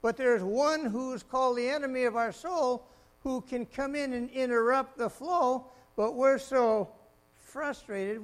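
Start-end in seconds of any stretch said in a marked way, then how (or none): none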